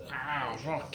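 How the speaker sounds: usual speaking voice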